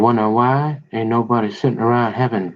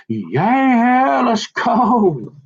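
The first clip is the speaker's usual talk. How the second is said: put-on excited kid voice; 9 semitones higher, much slower than usual. Kid's excited answer voiced.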